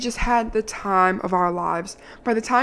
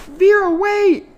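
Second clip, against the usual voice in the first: high, loud call across a room; 11 semitones higher, 7 dB louder, slower than usual. Retold or imitated. Driver calling after her at airport security, loud call. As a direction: shout a name to stop someone.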